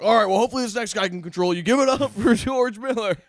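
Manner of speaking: high, loud, fast emcee announce; high and loud mock emcee voice; mock host intro. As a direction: ham up a club host handing off the crowd. Host introducing comic after heckling.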